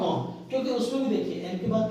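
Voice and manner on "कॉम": कॉम्ब as "kom", no b after m; silent b. Shown as a demonstration. Teacher confirming what students answered, silent b. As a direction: finish कॉम्ब on a plain m. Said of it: "क्या बोलते हैं?".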